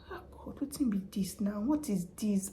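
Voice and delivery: hushed, exasperated aside under the breath; exasperated whisper. Retold or imitated. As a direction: mutter in disbelief to yourself. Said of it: "So I was like".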